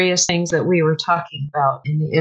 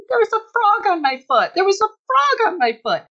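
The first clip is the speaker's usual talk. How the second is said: retold frantic cry, pitched much higher; mock frantic; 12 semitones higher than usual. Guest acting out telling her friends about the frog, frantic. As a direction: replay the panic, high and breathless. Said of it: "standing there jumping up and down screaming".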